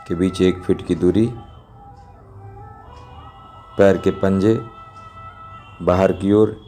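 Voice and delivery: ordinary talk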